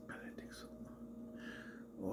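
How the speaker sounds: slow, barely audible whisper; exasperated whisper. Retold or imitated. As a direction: whisper it in drawn-out disbelief. Complaint repeated in a whisper.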